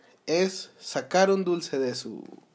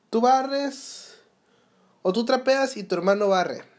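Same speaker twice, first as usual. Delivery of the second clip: imitating a bossy mom, pitched higher; mom giving orders; 6 semitones higher than usual. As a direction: play the mother handing out chores from a higher pitch. Mom assigning chores at home.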